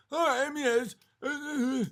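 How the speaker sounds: high-pitched imitation of someone stumped; put-on helpless stammering, pitched high; mock flustered stammer. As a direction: mimic a clueless opponent fumbling for words. Mimics opponent failing to define the term.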